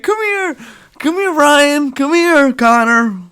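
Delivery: high, loud sing-song calling a dog; mock dog-owner call. Owner calling the dog in the park, high mock coo.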